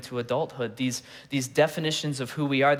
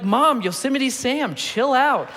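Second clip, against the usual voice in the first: put-on much higher, slow, whiny little boy; clueless kid; 9 semitones higher, slower than usual. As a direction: play the innocent kid brushing mom off. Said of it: "And I'm like".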